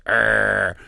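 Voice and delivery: a short throaty growl, lightly voiced; mock killer growl. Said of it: "she goes".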